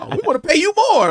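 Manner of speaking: high, loud excited praise; impressed. Retold or imitated. Crew praising the actor after the scene, impressed. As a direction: imitate someone loudly bowled over.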